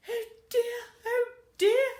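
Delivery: high, loud wailing oh dear; sobbing. Acted. Missus Gregg cries over the lost house, high wail. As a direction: wail it out, near tears. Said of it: "she sobbed".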